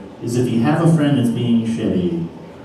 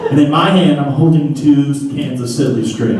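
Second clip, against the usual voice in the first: normal talking voice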